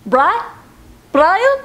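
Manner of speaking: insistent calling, building to a high pitch; Family Guy impression. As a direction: escalate each call in cartoon voice. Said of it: "Family Guy".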